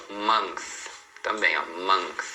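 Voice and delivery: month ending in a dental th; TH sound. Shown as a demonstration. Teacher models another word, th closing month. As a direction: end month with the tongue between the teeth. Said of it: "Com a língua entre os dentes".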